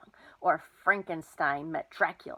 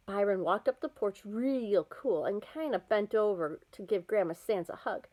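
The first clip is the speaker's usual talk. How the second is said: ordinary delivery, nothing marked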